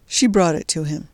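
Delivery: ordinary delivery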